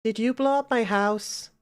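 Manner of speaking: put-on high-pitched child voice; little girl. Little girl asking who wrecked her home, high child voice. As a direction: question it like a small, scared child. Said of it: "there's a little girl".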